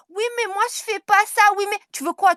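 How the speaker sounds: high, loud furious yelling; angry shout. Real, not acted. Shouting back at a rival during a live feud, furious yell. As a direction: yell it back at her, fuming.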